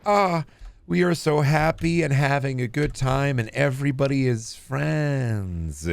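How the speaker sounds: mock sugary children's TV voice, high and bright at the start; fake cheerful kids' show. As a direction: parody a too-happy kids' host. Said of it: "current children's shows are like".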